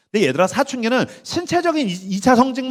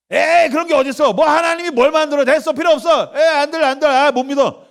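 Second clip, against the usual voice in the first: higher-pitched, hammed-up scoffer; dismissive scoffing; 6 semitones higher than usual. Preacher mimics people refusing Genesis, dismissive scoffing. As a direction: brush it off as a doubter. Voices doubters rejecting the verse.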